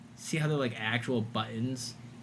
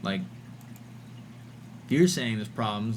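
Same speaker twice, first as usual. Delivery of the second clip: ordinary delivery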